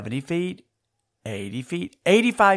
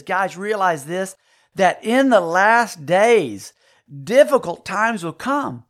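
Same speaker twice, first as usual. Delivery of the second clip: slow, weighty reading of the verse; slow scripture emphasis; 2 semitones higher, slower than usual. Shown as a demonstration. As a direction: draw each phrase out like a warning. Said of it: "and he says".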